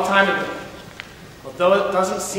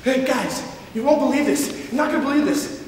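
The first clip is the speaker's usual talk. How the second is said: excited; 7 semitones higher than usual. Acted. Vern bursting with news.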